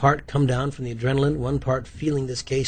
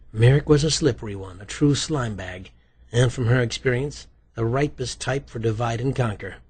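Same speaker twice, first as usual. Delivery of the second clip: usual speaking voice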